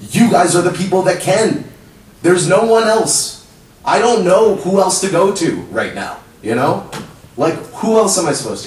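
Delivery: high, loud and slowed on no one else; desperate plea. Real, not acted. Telling the council there's no one else, high and loud. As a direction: push every word out, pleading.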